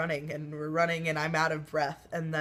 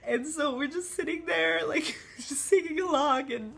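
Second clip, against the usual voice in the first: higher, tearful voice breaking into laughter; choked up while half-singing along; real tears starting; 9 semitones higher than usual. Real, not acted. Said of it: "a video in which I cry".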